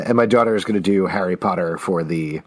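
ordinary delivery

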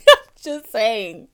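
loud, slow, through a laugh; amused, laughing disclaimer. Real, not acted. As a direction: laugh into it and drag the words out. Repeats it while laughing.

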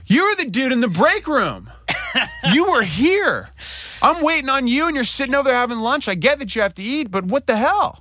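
high, loud indignant rant; retold dad calling out the IV guy; exasperated rant. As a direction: tear into him, half laughing in disbelief. Dad confronting the lazy IV guy.

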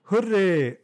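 high-pitched cheer; excited shout. A narrator's reading. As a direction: cry out hooray, drawn out. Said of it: "అని గట్టిగా అరిచి".

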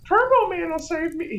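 put-on very high squeaky kid voice; defiant kid. Kid refusing Sinbad, squeaky high defiance. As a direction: squeak back like a stubborn little boy. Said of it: "And he's like".

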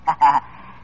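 short throaty chuckle; sly little laugh; low chuckle. Acted. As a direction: chuckle knowingly at your own cleverness. Informant chuckles to lieutenant.

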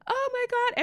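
very high mock-tearful exclamation; high-pitched acted gasp of feeling; imitated overwhelmed child. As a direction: play a child overcome with emotion. Acts crying child's reaction.

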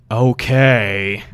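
low, smarmy one-word brush-off. Retold or imitated. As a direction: imitate a smug, dismissive stranger. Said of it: "gives me this smarmy look".

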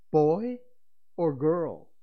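not too hopefully. A narrator's reading. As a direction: ask with little hope. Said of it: "not too hopefully".

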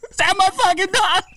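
high-pitched mock yell; shouted movie line. Topping a friend's Mystic River quote, high mock shout. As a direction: echo a film father's shout, hammed up. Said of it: "shouting just lines like this".

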